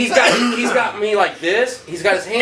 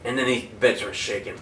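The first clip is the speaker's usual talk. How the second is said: ordinary delivery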